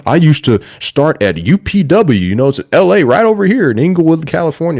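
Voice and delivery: pander to the crowd like a gushing politician; John Cena impression. Wrestling podcaster mocking Cena working the LA crowd, crowd-pleasing impression. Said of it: "You're nailing back the, uh, impression".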